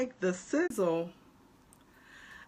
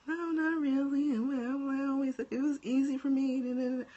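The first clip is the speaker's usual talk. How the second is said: higher voice of a self-doubting client; modest client; 7 semitones higher than usual. Retold or imitated. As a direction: imitate a client brushing off praise, pitched high. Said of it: "needed someone to say".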